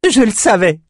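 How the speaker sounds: high, loud gleeful cry. A narrator's reading. Ambre gloating at the shepherd's news, gleefully. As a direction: crow it in triumph. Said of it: "jubila Ambre".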